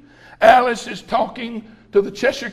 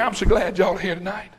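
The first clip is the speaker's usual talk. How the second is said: ordinary talk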